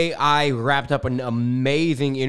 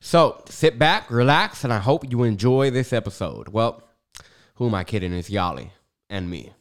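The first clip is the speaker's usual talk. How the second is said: usual speaking voice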